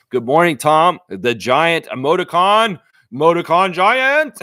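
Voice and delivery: loud, drawn-out announcer-like hail; booming greeting. Real, not acted. Host greeting Tom in the chat, booming hail. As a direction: boom out the name and nickname, stretching it slowly.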